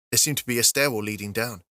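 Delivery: ordinary delivery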